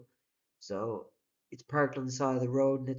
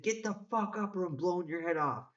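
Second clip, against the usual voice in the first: high-pitched mock threat; imitating a gunman's threat, raised pitch; 6 semitones higher than usual. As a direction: threaten like you'd pull the trigger.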